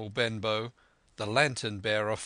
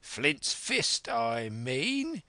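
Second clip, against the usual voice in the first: impatient, slightly raised retort; cursing blind man's sharp correction; angry cry; 3 semitones higher, much slower than usual. A narrator's reading. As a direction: cry it out in exasperation. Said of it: "he cried".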